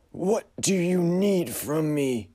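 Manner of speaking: high, slow uncanny question; otherworldly figure demanding; eerie high voice. Acted. Frederick asks.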